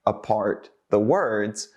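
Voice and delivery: very slow, word by word; over-slow, chopped-up delivery; slow and broken apart. Shown as a demonstration. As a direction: drag it out, separating each word. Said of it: "we don't say it very slowly or".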